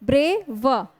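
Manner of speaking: Brave as two beats, ब्रे - व; split in two. Shown as a demonstration. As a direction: separate the व at the end of brave. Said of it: "one plus one आपके टू syllable".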